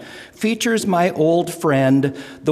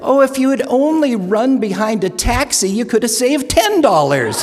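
Lena's voice; 8 semitones higher, 3 dB louder than usual. Retold or imitated. Lena's punchline in joke.

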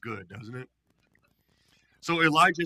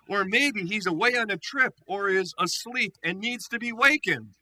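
mocking; 6 semitones higher, 5 dB louder than usual. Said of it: "Elijah began mocking them".

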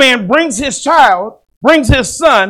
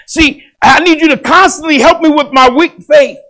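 father's desperate plea; 5 semitones higher than usual. Retold or imitated. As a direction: beg like a father at the end of his rope. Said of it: "and he finally said".

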